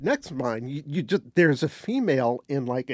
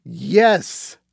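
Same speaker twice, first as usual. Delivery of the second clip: excited recognition; 2 semitones higher, 8 dB louder than usual. Retold or imitated. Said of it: "She went".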